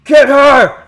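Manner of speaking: high, loud angry crowd shout; yelling. Acted. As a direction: bark the order to attack. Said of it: "the town yells out".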